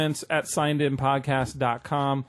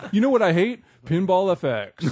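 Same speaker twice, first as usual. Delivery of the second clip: higher, louder grouchy complaint; mock grumpy rant; 4 semitones higher, 4 dB louder than usual. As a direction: parody a cranky host. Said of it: "And be like".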